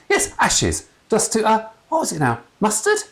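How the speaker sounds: high squeaky nervous voice; bumbling priest squeaking. A narrator's reading. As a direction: squeak it like a flustered young priest. Flustered priest keeps talking.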